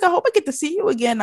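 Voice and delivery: high, rapid daughter voice; high-pitched mock-polite farewell; imitated polite daughter. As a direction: chirp a quick goodbye in a high voice. Voices the daughter saying goodbye.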